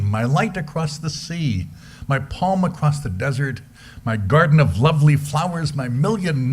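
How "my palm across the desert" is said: normal talking voice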